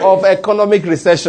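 loud, convinced declaration; like you mean it. Shown as a demonstration. Preacher has the congregation repeat after him, loud and convinced. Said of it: "do it like you mean it".

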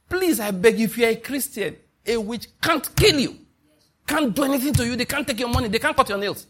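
high, pressing plea to the listeners; urgent pleading. Real, not acted. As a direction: beg them to believe it. Pleading warning to the congregation.

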